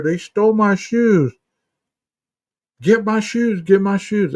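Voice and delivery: put-on high pleading voice of a frightened girl; a hammed-up frantic girl begging for her shoes; imitating the patient. Nurse mimicking psychotic girl.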